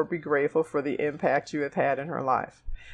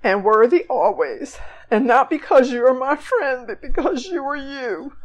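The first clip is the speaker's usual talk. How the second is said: choked up, higher; 7 semitones higher, 5 dB louder than usual. Real, not acted. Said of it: "I'm gonna cry again".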